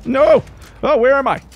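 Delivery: high, loud frantic cry; real gamer panic, voice shooting up; panicked yelling. Real, not acted. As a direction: cry out as if suddenly lost. Lost and panicking in game.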